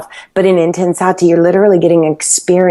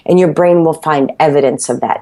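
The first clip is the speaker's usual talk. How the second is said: usual speaking voice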